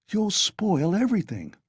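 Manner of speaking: plain reading voice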